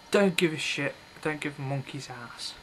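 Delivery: normal talking voice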